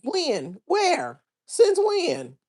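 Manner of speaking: high-pitched disbelieving questions; incredulous. Real, not acted. Disbelieving questions at a chat claim.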